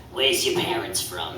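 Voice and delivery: a hint of a gruff dad asking; dad impression. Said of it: "I'll do an impression of my dad".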